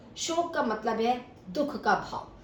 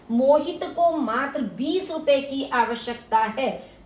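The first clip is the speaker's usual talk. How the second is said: slow and higher, मात्र बीस stressed; 4 semitones higher, 4 dB louder than usual. Shown as a demonstration. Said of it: "मोहित के साथ मात्र शब्द आ गया". (मात्र पर ज़ोर देकर)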